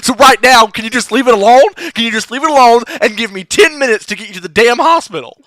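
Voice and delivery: fed up, demand he drop it; angry at the nagging cleric; exasperated. Acted. Player character argues with cleric.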